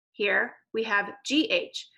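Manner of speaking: slow letter naming G H; G H spelled out letter by letter; spelled digraph. Shown as a demonstration. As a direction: say each letter slowly. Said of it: "Practice with me".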